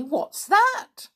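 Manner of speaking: very high, loud startled questions; amazed, squeaking-up questioning; astonished exclamation. A narrator's reading. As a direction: demand it in high-pitched surprise. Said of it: "exclaimed Sammy".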